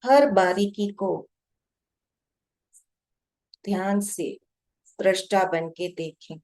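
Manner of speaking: measured meditation guide, long pauses between short phrases; guided meditation. Real, not acted. Guide to meditators watching their breath, calm guided meditation. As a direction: guide them unhurriedly, phrase by phrase, leaving long silences.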